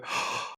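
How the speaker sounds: sharp intake of breath, acted out; demonstrated gasp of excitement; excited gasp. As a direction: gasp as if thrilled to hear it. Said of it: "I go".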